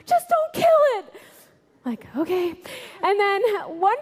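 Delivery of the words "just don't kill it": high, louder plea to spare the mouse; pleading coworker. Retold or imitated. Coworker calling out to spare the mouse, high pleading voice. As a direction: beg them not to kill it.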